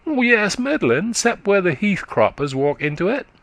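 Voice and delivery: put-on high, quick childish voice of a country boy; little boy chatting up to a tired woman, high and eager; chattering little boy. A narrator's reading. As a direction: prattle like a small eager lad. Boy answers.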